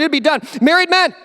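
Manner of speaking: ordinary talk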